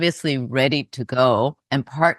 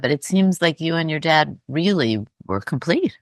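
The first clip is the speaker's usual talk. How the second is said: ordinary talk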